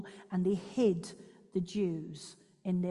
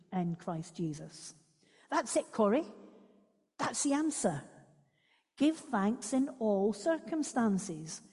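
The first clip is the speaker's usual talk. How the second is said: expressive read-aloud of a memoir; story reading. Acted. Speaker reads Corrie ten Boom's memoir to listeners, expressive read-aloud. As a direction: read the extract aloud, voicing the sisters. Reads book extract to congregation.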